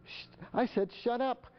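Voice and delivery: high-pitched mock backtalk; lightly acted kid talking back to mom; defiant adolescent. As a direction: insist on it like a defiant teen. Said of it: "And they say".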